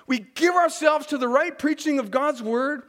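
ordinary talk

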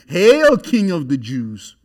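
loud, slow mock homage to a prisoner. Soldiers mocking the beaten prisoner, loud slow mock salute. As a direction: jeer it out slowly, mock royal greeting. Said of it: "because they're mocking him".

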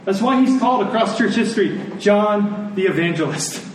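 ordinary talk, nothing marked

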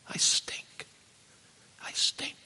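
breathed whisper, ashamed. Retold or imitated. Son whispering to his father, ashamed. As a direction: whisper it in shame. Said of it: "I'd say".

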